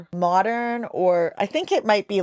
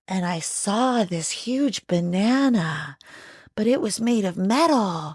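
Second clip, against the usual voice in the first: naive narrator voice. Retold or imitated. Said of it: "you wouldn't be like".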